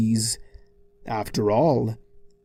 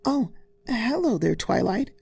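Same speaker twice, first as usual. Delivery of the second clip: lovely Fluttershy tone; 10 semitones higher than usual. A narrator's reading. Fluttershy opening the door to Twilight, sweet and lovely. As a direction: greet a friend sweetly, up high. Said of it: "in a usually lovely tone".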